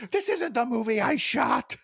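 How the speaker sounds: high indignant diva. Retold or imitated. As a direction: squeal it up high, offended. Voicing Judi complaining.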